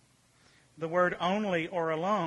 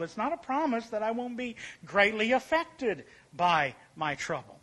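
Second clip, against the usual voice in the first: usual speaking voice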